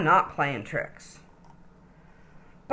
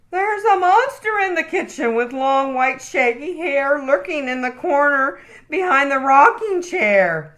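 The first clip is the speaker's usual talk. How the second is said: scared bunny; 8 semitones higher, 4 dB louder than usual. A narrator's reading. Okie telling of monster.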